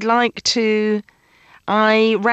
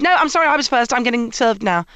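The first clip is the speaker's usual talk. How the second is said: higher, curt and insistent; firm insistence; 6 semitones higher than usual. Retold or imitated. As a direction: claim your turn without apology. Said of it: "I don't do any of the".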